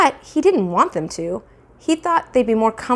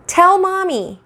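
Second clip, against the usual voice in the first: high insistent mother; pressing mother; 11 semitones higher, 3 dB louder, slower than usual. Acted. Mother pressing Fudge for answer, high insistent voice. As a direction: demand it of the toddler.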